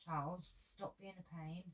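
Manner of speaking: soft, hushed scolding aside; hushed telling-off of the dog; quiet aside. Real, not acted. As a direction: mutter it away from the mic to Charles. Scolding someone off-camera, aside.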